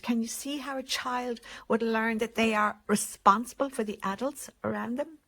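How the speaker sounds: usual speaking voice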